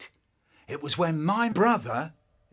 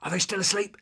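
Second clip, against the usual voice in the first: normal talking voice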